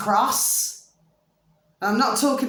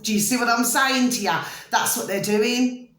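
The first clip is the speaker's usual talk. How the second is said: ordinary talk, nothing marked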